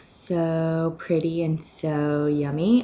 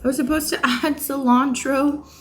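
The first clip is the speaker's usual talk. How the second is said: laughing through the words, higher; 8 semitones higher, 5 dB louder than usual. Real, not acted. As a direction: giggle while owning up to laziness. Laughs at own laziness.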